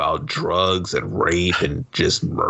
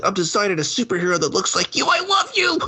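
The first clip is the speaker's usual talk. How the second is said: high, gushing, rising to a squeak on love; mock lovestruck guy; 12 semitones higher than usual. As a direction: ham up a smitten nerd. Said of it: "and he's like".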